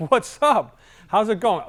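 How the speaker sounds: put-on light up like meeting an old friend; upbeat greeting to an outgoing client; bubbly greeting. Shown as a demonstration. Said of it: "The I's like".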